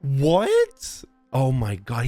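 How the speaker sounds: ordinary talk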